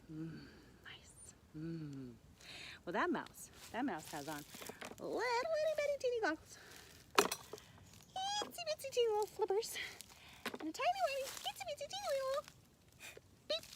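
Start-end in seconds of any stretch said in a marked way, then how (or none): 0.1-2.1 low, soft savoring hum; relishing bunny. Acted. Bunny spotting a mouse from afar, low relishing murmur. 5.0-13.7 put-on very high teeny-tiny patter; cutesy tiny. A narrator's reading. Storyteller listing a mouse's snorkeling gear, very high cutesy voice.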